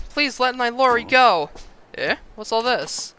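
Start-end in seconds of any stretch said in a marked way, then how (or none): 0.1-1.5 pleading. Acted. Mother begs cult to release daughter.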